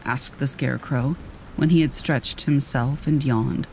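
ordinary delivery

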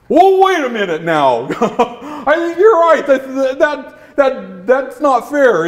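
high dog voice, halting realisation; high, stammering cartoon dog agreeing; dog leader won over. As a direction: come round to the complaint, voice rising. Dog leader changes his mind.

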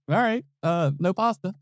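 high resigned repeating; Steve going along. Retold or imitated. Host Steve repeating the odd answer, high and resigned. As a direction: humour the contestant. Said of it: "And Steve's like".

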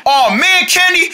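usual speaking voice

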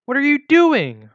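high, loud, drawn-out exclamation; crying out. A narrator's reading. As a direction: cry out in alarm, stretching the words. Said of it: "Robin cried out in response".

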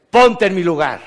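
hammed-up aggrieved demand; mock indignant plea. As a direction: toss it out like an aggrieved aunt at Christmas. Quoting a complaining relative.

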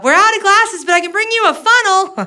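high call up the stairs; mock yell up the stairs. Granddaughter yelling up to grandma about a funnel, mild mock yell. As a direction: call up cheekily. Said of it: "I yelled up".

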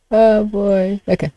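ordinary talk, nothing marked

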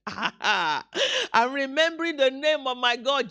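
slow words through acted laughter; laughing. Believer tells onlookers about God's name, laughing. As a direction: let the laugh carry into the words. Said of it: "you can just start laughing".

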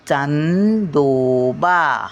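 चांदोबा slowly, with a clear nasal on चां. Shown as a demonstration. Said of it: "मग चावर टिंब द्यायचं". (चांदोबा मध्ये चां वर अनुस्वार ओढून)

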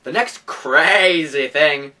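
ordinary delivery

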